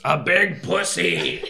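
loud, high, drawn-out taunt with a laugh in it; yelled heckle. Acted. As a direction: yell it from the back of a ship. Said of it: "Harbeck from the back of the ship yells".

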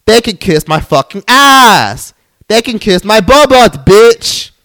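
loud, high, drawn-out defiance; heated. Real, not acted. Podcaster fed up with non-apologisers, heated. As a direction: fire it off angrily, leaning long on the insult. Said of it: "I'm all the way heated".

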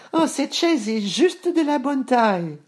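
put-on very high-pitched little-girl voice; delighted little girl. A narrator's reading. Boucle d'Or delighted to find a chair that fits, high girlish delight. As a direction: pipe it up high, pleased.

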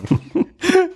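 high giggle burst; short squeaky giggle; stifled giggles. Real, not acted. Host laughs at own greeting.

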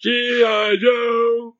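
very high, very slow "G I Joe" sung out; announcer parody. Pretend. Host mocks the cartoon slogan, high and drawn out. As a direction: stretch "G I Joe" like a cartoon jingle.

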